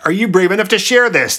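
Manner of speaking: high-pitched, pressing demand; insistent demand. A narrator's reading. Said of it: "demanded the Sphinx".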